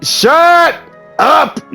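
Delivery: ordinary delivery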